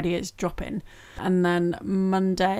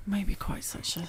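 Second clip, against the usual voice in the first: whisper; 10 dB quieter, slower than usual. Real, not acted. Teasing aside about surprise breaks.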